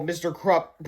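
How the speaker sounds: plain reading voice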